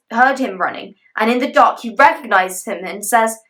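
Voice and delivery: plain reading voice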